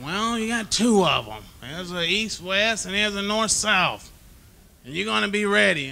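loud, high, slow coach's orders; imitating the coach. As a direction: boom it out like an old-school coach laying down the law. Coach voiced in retold story.

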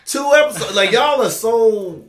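high, loud, drawn-out disbelief; incredulous comic outburst; mock exasperation. Mock exasperation at sparse British series.

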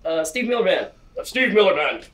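hammed game show voice. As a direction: call out the answer like a contestant. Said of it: "stumping me here on my game show voice".

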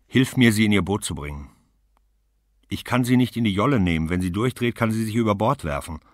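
loud, hurried alarm; worried and pressed; urgent. Acted. Woman warns about taking her in the boat, loud and urgent.

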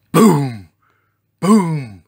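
loud, falling "boom"; booming footsteps. A narrator's reading. Giant dinosaur comes through the trees, booming. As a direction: thud out each giant step.

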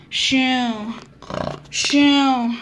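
normal talking voice

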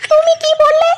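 high put-on wife's voice, indignant; offended wife. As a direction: bristle high as the insulted wife. Wife quarrels with husband. (স্বামীর অপবাদে রেগে যাওয়া বউয়ের উঁচু গলা)

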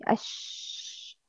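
"Ash" with a very long airy sh; spread tafashi. Shown as a demonstration. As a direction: spread the sh of "Ash" into a sustained hiss. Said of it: "Spread the sound".

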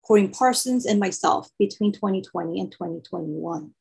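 usual speaking voice

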